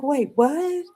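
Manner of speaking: high, stunned double take; disbelief. Retold or imitated. Driver reacting to rider praising the old coach, high disbelief. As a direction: squeak it out in shock. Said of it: "I was like".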